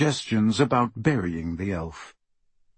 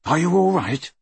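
higher, anxious check on someone hurt; concerned question; 7 semitones higher, 3 dB louder than usual. A narrator's reading. Harry to the injured goblin he lowered, concerned. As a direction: ask with worry, pitch raised.

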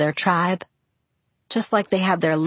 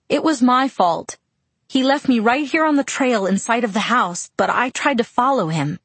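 desperate; 6 semitones higher than usual. Acted. Bella frantic, desperate to escape pain.

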